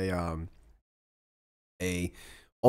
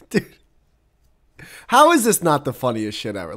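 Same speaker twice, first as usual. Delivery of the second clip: ordinary talk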